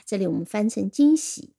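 ordinary talk

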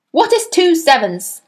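stern demand. A narrator's reading. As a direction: fire the question at him. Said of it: "she barked".

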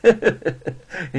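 high, forced sneering chuckle; fake gloating laugh. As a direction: laugh smugly without mirth. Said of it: "皮笑肉不笑地看着". (皮笑肉不笑地干笑)

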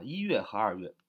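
ordinary talk

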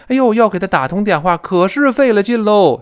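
high, loud lament, dragging out the end; acted exasperation. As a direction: moan about how hard it was. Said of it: "是这么来说".

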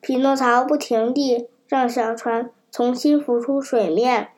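ordinary delivery, nothing marked

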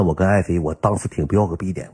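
ordinary talk, nothing marked

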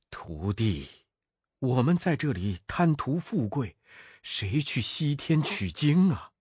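an anxious master at a loss; worried monk. Acted. Tripitaka asks Monkey what to do, softly worried.

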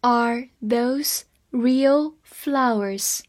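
very slow, every word clear; slow reading. Shown as a demonstration. Teacher reading slowly to students, every word clear. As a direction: read it slowly for learners. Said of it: "好，慢慢来读。".

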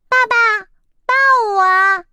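put-on high childish plea to be held; spoiled chick asking daddy for a carry; whiny little chick. A narrator's reading. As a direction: beg to be picked up like a toddler. Chick demands to be carried.

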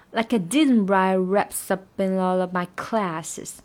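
didn't stressed, line a bit slow. Shown as a demonstration. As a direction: lean on didn't. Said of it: "然后didn't要重读". (didn't重读)

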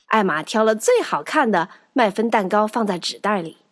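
usual speaking voice

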